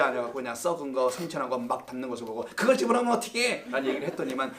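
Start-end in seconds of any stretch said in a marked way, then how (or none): 2.5-3.6 scolding. Retold or imitated. Deacon scolding at persimmon picking.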